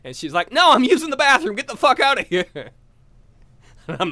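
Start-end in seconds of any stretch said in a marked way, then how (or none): 0.5-2.4 angry yell. Retold or imitated. Woman yelling from bathroom.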